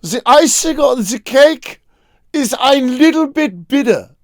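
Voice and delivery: high, slow mock German child; little Albert's first words. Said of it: "he just goes".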